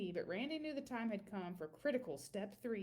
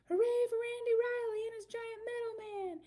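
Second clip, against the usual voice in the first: much higher, loud cheering; crowd cheer; 13 semitones higher, 5 dB louder than usual. Acted.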